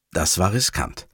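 ordinary delivery

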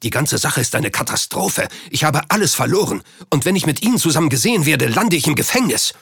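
acted alarm that quickens as it builds; agitated panic. A narrator's reading. Banker panics over being ruined.

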